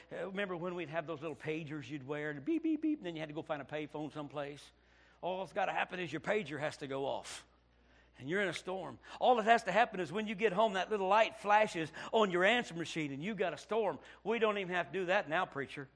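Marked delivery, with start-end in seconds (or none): 2.4-2.8 high mock beeping of an old pager; pager beeps. Preacher imitating the old pager beeping. 9.2-14.0 louder, rising preacher's cry; preaching louder. Acted. Preacher telling the church the storm comes, louder. Preaching building to full force.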